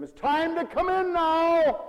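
put-on high, loud, drawn-out call to the kids; parent calling out. Parent calls children having fun outside, loud calling. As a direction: call them in from play, stretching it out.

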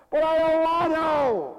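put-on high, loud, drawn-out child's whine; protesting child. Child answers parent calling them in, loud whiny protest. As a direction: wail it like a kid refusing to come in.

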